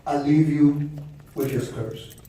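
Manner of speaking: low, hushed mock curse; laying a curse. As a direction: intone it like a solemn spell. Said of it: "I'ma leave y'all with a curse".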